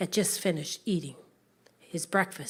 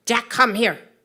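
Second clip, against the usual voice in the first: louder, summoning; firm loud call; 3 semitones higher, 10 dB louder than usual. Acted. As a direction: order him over, no nonsense. Mother calling her son.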